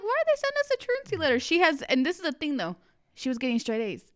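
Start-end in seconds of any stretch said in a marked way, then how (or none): 0.0-1.4 high, fast disbelieving question; mock outrage at the letter; incredulous. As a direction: toss it up in disbelief. Incredulous retold complaint.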